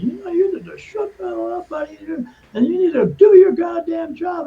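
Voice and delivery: retold cursing tirade. Guard cursing at a coworker to do his job, angry shout. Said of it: "I started cursing a whole bunch".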